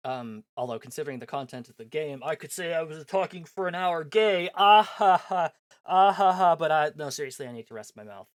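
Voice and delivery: ordinary talk, nothing marked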